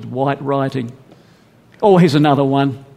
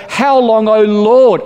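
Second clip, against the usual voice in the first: high pleading cry, louder; crying out to God; 6 semitones higher, 5 dB louder, slower than usual. Acted. Souls under the altar crying out to God, loud plea. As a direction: cry out how long as the souls. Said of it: "they cry to God and they say".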